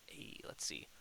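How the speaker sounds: soft whispered aside. Real, not acted. Gamer whispering while checking a tool. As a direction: whisper it under your breath.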